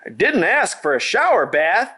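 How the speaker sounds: retort. Acted. Wet farmer retorts.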